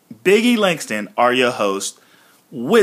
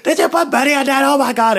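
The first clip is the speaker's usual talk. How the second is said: much higher, fast hammed-up panic; high, rushed mock terror; mock freak-out; 12 semitones higher, faster than usual. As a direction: play Big E losing it over Bunny. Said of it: "Big E's freaking out".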